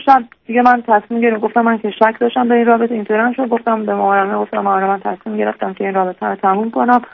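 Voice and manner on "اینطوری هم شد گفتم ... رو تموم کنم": ordinary talk, nothing marked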